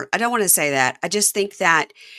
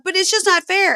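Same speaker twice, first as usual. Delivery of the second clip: mock whiny protest; 14 semitones higher, 4 dB louder than usual. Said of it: "and she was like".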